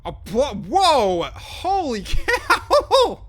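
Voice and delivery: high, loud, slow stunned whoa; gasping laugh of disbelief; astonished. Real, not acted. Sees huge distance on map.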